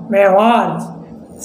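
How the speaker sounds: मेवार्ज repeated very slowly and clearly. Shown as a demonstration. Teacher to students, repeating the example very slowly. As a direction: draw out मेवार्ज for the class to copy. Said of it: "example है मेवार्ज".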